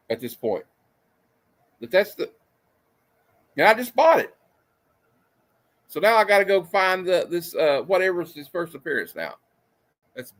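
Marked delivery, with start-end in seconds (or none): 1.8-7.5 exasperated. Real, not acted. Ranting, voice jumps up.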